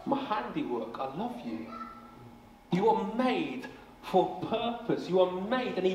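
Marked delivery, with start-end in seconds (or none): 0.0-1.7 God gazing at his creation, tender and soft. Pretend. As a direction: hush it down, full of tenderness. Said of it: "God looks at you and says".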